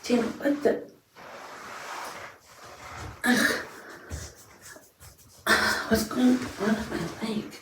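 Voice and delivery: loud startled yelp; sudden alarmed outburst. Real, not acted. Startled by something with leg.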